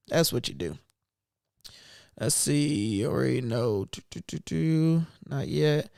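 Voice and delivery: usual speaking voice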